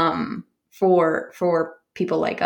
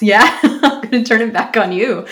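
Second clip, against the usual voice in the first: laughing while speaking, playful; 5 semitones higher, 8 dB louder than usual. Real, not acted. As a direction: laugh through the line, teasing. Turning the question back, laughing.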